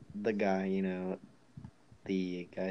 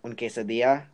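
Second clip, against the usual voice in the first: put-on Spanish accent; 4 semitones higher, 9 dB louder than usual. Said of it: "the guy behind the counter is like".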